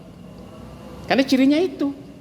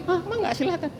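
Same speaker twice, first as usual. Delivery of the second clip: mock careless shrug; 13 semitones higher, 5 dB quieter than usual. Mocking a man who never gets angry.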